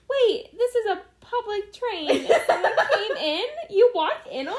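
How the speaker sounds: high, loud, laughing mock outrage; mock indignant man. Co-host voicing the window man, offended, laughing. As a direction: play the caught man as offended.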